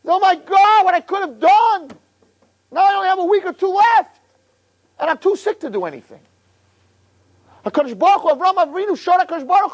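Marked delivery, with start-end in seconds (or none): 0.1-6.2 high, stricken outcry; anguished realization. Acted. Dying man hearing his prognosis, crying out in regret. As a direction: cry out in sudden regret.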